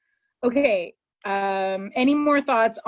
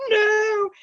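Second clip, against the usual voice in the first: mock anguished no; 12 semitones higher, 4 dB louder than usual. Director snaps at Parker's answer.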